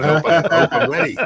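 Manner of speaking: laughing. Real, not acted. Guest laughs nervously.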